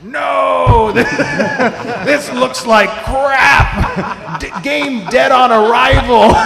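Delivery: loud, laughing mock outrage; mocking shout. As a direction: yell it like an angry fan. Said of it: "And the community's like".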